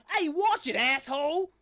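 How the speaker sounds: high, loud street-corner yell; angry shout. Acted. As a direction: yell at whoever bumped into you. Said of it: "a voice that just goes".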